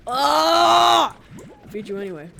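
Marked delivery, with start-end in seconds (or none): 0.1-1.2 loud sudden hiccup yelp; a hiccup jolts out loud. Real, not acted. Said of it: "I hate hiccups".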